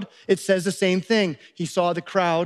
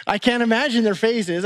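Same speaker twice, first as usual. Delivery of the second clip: ordinary talk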